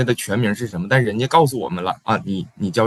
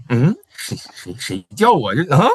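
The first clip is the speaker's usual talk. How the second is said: startled, looking around who called; mock startled "who's calling me?"; puzzled surprise; 5 semitones higher than usual. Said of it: "就这种". (被直呼大名时吃惊疑惑)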